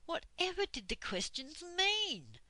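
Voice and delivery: ordinary delivery, nothing marked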